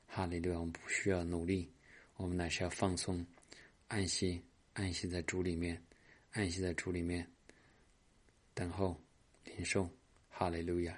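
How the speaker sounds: soft, murmured charismatic prayer; a pastor praying softly over the congregation; hushed prayer. Acted. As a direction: pray quietly, welcoming the Spirit. Leads a soft Spirit prayer.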